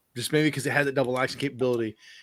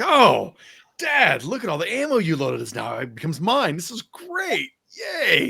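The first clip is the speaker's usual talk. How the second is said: high, hammed-up delight; mock glee; 6 semitones higher, 4 dB louder, slower than usual. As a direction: squeal thanks like a kid at Christmas. Acts out mock Christmas glee at ammo.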